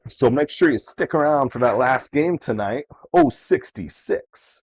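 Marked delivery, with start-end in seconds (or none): 3.1-4.6 slow drawn-out number call; bingo caller. Acted. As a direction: stretch the number out, bingo-hall style.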